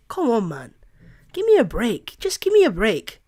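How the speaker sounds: usual speaking voice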